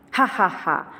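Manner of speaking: a shopkeeper's scornful ha ha ha; mocking laugh. A narrator's reading. Shopkeeper laughing at the tiny mouse, mocking laugh. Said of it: "दुकानदार हंसा".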